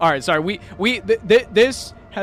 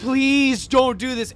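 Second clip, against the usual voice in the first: mock crying plea; 9 semitones higher, 3 dB louder, slower than usual. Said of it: "starts fucking breaking down and crying".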